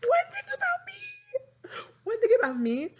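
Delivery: high, slow fake wail; mock wailing. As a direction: ham up a teary wail, stretched out. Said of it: "I'm gonna cry".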